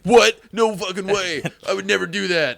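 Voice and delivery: high, loud indignant denial; hammed-up shocked protest; mock outrage. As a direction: burst out as if deeply offended. Acts man outraged by suggestion.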